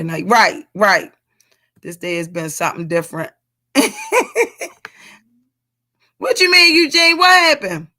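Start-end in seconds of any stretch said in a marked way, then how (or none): 3.7-4.9 sudden high squeal of laughter; high delighted laugh. Real, not acted. Reacting to a comment. 6.2-7.9 higher, louder, surprised question. Real, not acted. Reacting to a comment.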